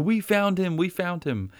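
high, eager cry of discovery; giddy, high announcing; excited exclaim. Retold or imitated. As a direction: burst out like you've found treasure. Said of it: "Philip saying".

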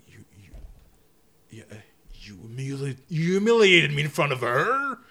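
mumbled then bursting into a roar; Kingpin voice. Retold or imitated. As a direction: start mumbling, explode at the end. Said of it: "he just talks like this".

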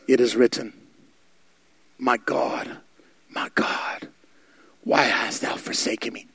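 low, soft, halting near-whisper; hushed anguished quote. Acted. Jesus on the cross calling to God, hushed and halting. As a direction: let it sink to a faint, broken-up murmur.

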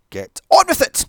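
loud, high, impatient shout at the screen; high frustrated outburst; exasperated yell. Real, not acted. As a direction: bark it at the film, fed up.

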